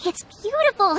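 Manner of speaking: wide-eyed awe at the rice grains; amazed. Acted. Admiring the craftsman's work.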